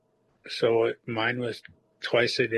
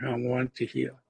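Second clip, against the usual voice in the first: ordinary delivery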